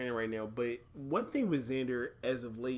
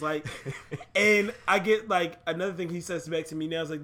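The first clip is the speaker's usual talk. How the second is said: ordinary talk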